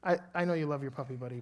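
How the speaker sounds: ordinary delivery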